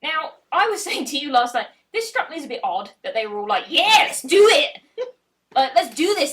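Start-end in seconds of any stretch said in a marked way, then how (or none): 3.7-6.3 hammed-up eagerness. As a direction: imitate a rowdy crowd egging him on. Acted crowd cheering the challenge.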